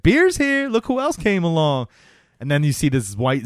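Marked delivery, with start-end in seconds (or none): 0.0-1.9 party host cheer. Retold or imitated. Host greeting newcomers at a backyard party, excited. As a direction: announce it like the party just got better. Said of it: "He's like".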